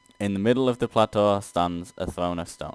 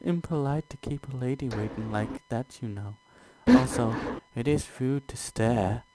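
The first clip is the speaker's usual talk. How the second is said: higher, softer, teasing demoness; wicked quip; 3 semitones higher, 4 dB quieter than usual. Acted. Omnibus teasing the staring adventurer, in a higher soft wicked tone. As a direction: tease him sweetly with a wicked smile. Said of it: "The omnibus quips with a wicked smile".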